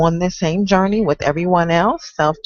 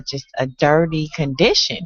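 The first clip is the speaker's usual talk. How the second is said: normal talking voice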